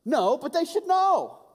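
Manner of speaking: hammed-up high indignant protest; indignant friend. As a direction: whine it like he's been wronged. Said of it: "And he said".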